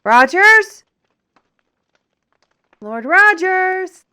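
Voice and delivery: loud, drawn-out call for Lord Rogers. Acted. Searching for Rogers in his cluttered room, calling loudly.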